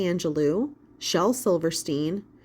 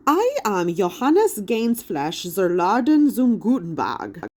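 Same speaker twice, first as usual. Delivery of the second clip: put-on stately, slow German-accented introduction; 2 semitones higher, 4 dB louder than usual. A narrator's reading. Hologram Gutenberg introduces himself, slow German accent. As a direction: announce his full name grandly in a German accent. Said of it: "with a thick German accent".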